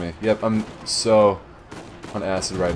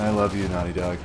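softer, lower, tender Naughty Dog; affectionate; 5 semitones lower, 5 dB quieter than usual. Real, not acted. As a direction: murmur it fondly like a love note. Declaring love to the studio.

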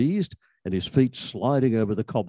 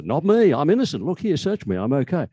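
mock innocent protest; 6 semitones higher than usual. Pickpocket protesting his innocence.

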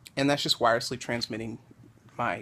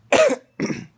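sharp cough; 12 dB louder than usual. Real, not acted. Post-surgery cough.

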